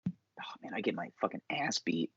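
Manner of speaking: hushed, breathy aside; whispering. Real, not acted. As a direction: drop to a whisper. Imagining jail, hushed aside.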